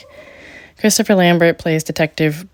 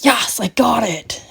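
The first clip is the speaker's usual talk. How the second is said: mock triumphant detective; 7 semitones higher, slower than usual. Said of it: "And he's like".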